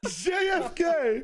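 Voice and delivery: incredulous repeat. Real, not acted. Repeating the absurd answer.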